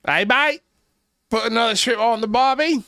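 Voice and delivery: put-on a strained, overdone Australian accent. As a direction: push the Australian accent further, hamming it. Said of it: "I tried".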